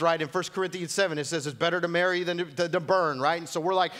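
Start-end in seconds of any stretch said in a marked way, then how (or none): none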